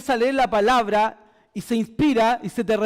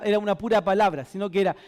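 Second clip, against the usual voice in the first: normal talking voice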